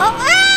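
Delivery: high startled shout. Real, not acted. Dies in the game.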